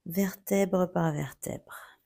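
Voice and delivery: slow, spaced yoga cue; slow, step by step. Shown as a demonstration. Yoga teacher guiding the roll up, slow and spaced. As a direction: draw each word out slowly, vertebra by vertebra. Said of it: "très lentement".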